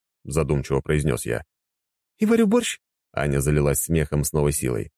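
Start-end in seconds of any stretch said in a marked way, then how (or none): 2.2-2.8 higher woman's voice, amused; amused wife. Acted. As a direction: ask in amused disbelief. Anya voiced, laughing question. (весело, с насмешкой)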